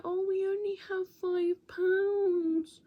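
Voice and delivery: put-on high, slow little-kid voice pleading; pleading child. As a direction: sound like a small child begging. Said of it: "being like".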